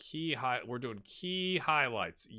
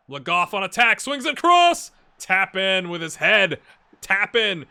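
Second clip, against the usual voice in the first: much higher, louder excited commentary; goal call; 6 semitones higher, 14 dB louder than usual. Acted. Gamer calling his team's goal, excited commentator.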